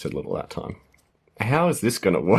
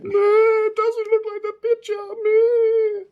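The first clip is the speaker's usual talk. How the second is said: very high-pitched mock whining kid; crying child; 3 dB louder, slower than usual. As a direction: wail like a disappointed child, hammed-up. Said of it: "children at this stage will be start crying".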